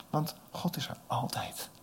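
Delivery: whispered softly, full of awe; reverent whisper. Real, not acted. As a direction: hush it like a secret. Reverent statement about God. (eerbiedig fluisterend)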